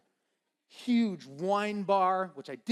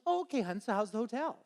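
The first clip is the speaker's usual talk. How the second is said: chatty mom; 6 semitones higher, faster than usual. Retold or imitated. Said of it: "she goes".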